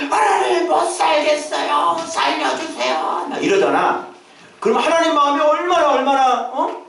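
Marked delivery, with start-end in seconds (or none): 0.1-3.3 desperate crying out. Acted. Suffering people crying to God for rescue, acted desperate wail. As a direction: wail slowly, begging to be rescued. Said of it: "막 부르시죠".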